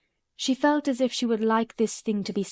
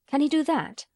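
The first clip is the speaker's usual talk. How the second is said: a little higher, eager question; 6 semitones higher than usual. A narrator's reading. Boy asks Mary about the snake charmer, eagerly. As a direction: ask it keen to know. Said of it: "he asked eagerly".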